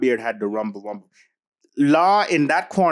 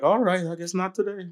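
higher-pitched mock retreating voice; Green Ball backing off; 6 semitones higher than usual. Podcaster imitates a fighter backing down for his co-host, higher mock voice. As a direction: imitate someone meekly giving up, pitched up. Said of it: "Green Ball's like".

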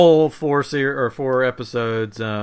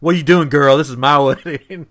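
mock indignant bride, louder; [name]; 5 dB louder than usual. [name] to the wedding crasher, loud mock indignation. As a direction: call out the intruder with playful outrage. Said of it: "it was sort of a look like".

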